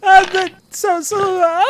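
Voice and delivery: loud high laughter breaking through words; trying to talk through laughing; laughing hard. Real, not acted. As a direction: GM losing it at the player's joke, laughing. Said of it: "GM cracks up".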